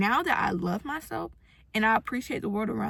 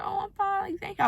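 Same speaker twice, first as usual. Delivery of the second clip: polite brush-off; 10 semitones higher than usual. Retold or imitated. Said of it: "I was like".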